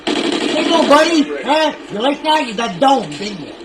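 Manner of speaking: loud, high mocking yell; gloating shout. Real, not acted. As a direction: crow over the kill at the top of your voice. Taunting a killed camper.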